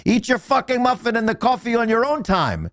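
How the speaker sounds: very high-pitched mock tirade; retold furious boss. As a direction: imitate an outraged boss with the pitch way up. Boss scolds new hire.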